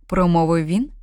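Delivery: usual speaking voice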